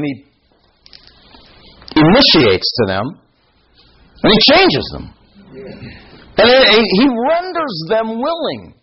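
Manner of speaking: high, ringing preacher voice building up; impassioned preaching. Acted. Preacher to congregation on Christ's mercy, soaring and passionate. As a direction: lift it up and proclaim with fire.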